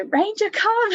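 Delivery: high, loud urgent call to a dog; retold scream. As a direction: scream out the recall command, re-enacted. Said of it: "I just screamed".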